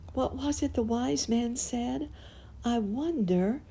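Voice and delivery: high, lilting musing to oneself; puzzled little clown, pitched high; wondering aloud. A narrator's reading.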